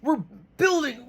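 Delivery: high, hammed-up cheer of a company hyping itself; mock patriotic cheer. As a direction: cheer "hoorah, America" like a rah-rah mascot. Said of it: "They're like".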